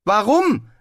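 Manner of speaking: loud, high-pitched call; rufen. A narrator's reading. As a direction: call out the question eagerly. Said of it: "rief Zweiblum". (laut und hoch gerufen)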